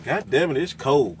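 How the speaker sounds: normal talking voice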